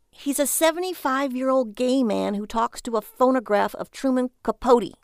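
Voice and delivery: a hint of a wife losing patience; wife scolding her husband about his buyer; exasperated wife. Lily's voice in the story.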